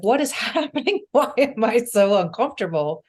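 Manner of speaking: high-pitched, half-laughing mock distress; playfully overdone bewildered complaint; exasperated plea. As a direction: plead upward, exaggerating the bewilderment. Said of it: "I'd be like".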